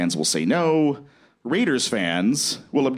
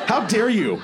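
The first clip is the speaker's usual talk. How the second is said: high-pitched playful indignation; mock outrage; 12 semitones higher than usual. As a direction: pretend to be scandalised, voice jumping up.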